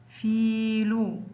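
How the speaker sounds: فيل very slowly, في held long; drawn-out example word. Shown as a demonstration. Teacher showing pupils the long vowel, slow and stretched. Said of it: "ينطق في".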